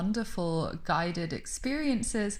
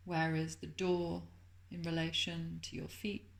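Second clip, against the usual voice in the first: soft, calm meditation voice with lingering pauses; guided meditation; 9 dB quieter than usual. Acted. Teacher settling listeners into Yoga Nidra, soft guided meditation. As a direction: gently guide them into rest, much softer than a chatty welcome.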